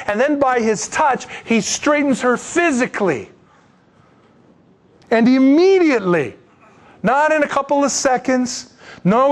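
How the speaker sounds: usual speaking voice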